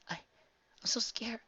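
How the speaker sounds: high, slow frightened whisper; quivering. A narrator's reading. Twilight whispering her fear to Spike. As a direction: barely breathe it out in fear. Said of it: "Twilight quivered".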